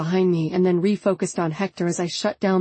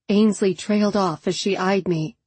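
usual speaking voice

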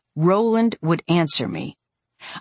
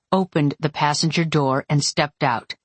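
usual speaking voice

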